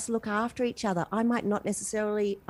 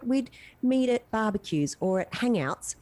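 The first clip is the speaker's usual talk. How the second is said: ordinary delivery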